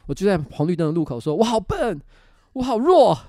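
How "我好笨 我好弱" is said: loud frustrated yell at himself; shouting at himself. Retold or imitated. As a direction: yell it out at the red light. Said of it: "我就大吼了出来". (对着自己大吼)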